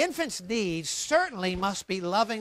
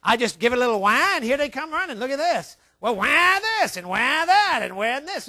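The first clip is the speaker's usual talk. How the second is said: higher, louder pleased little schemer; scheming baby's voice; 4 semitones higher, 5 dB louder than usual. Acted. Baby discovers that crying works on parents, delighted high voice. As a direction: play a baby gleefully working the parents. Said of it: "He say".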